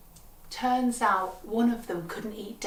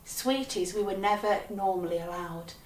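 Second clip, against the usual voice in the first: plain reading voice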